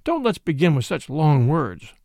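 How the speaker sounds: ordinary delivery, nothing marked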